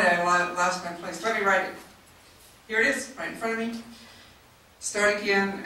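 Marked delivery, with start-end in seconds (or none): none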